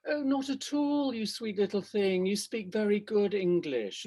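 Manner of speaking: high, slow, cooing condescension; patronizing. Acted. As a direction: coo it slowly, as if praising a small child. Acted condescension to colleague.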